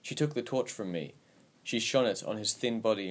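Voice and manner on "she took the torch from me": ordinary delivery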